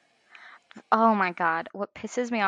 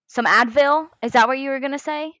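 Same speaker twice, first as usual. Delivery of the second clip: louder, quick, mocking questions; mock-suspicious retort to husband, louder and fast; sarcastic comeback; 5 semitones higher, 8 dB louder than usual. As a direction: needle him for the offer.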